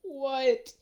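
a sharp, high "what"; shocked. Retold or imitated. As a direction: caught completely off guard. Boys react in shock.

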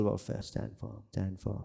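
breathy whisper, almost no voice; whispered command. Acted. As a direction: whisper it like a secret charge. Whispered exhortation.

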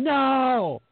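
high, drawn-out mock wail of protest; mock scream. Retelling a player who won't get up, mock screaming. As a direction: imitate a stubborn player screaming, without full volume. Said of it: "he's screaming".

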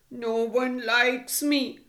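put-on mild, slow lament of a rejected young turtle; slowly, with a touch of self-pity; sad turtle. Blue turtle voices being teased.

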